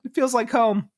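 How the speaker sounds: high, playful voice of fond recognition; mock nostalgic contentment. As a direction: float it up high, as if coming home. Voicing a nostalgic, cosy reaction to natty wine.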